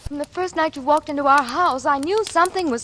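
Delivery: fierce accusation. Acted. Sylvia telling Dix she knew something was wrong. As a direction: stand up to him at last.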